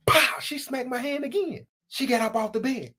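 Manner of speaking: ordinary talk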